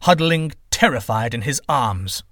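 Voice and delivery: lively storyteller reading a myth aloud; story narration. A narrator's reading. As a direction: tell the legend with wonder and energy.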